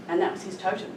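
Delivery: normal talking voice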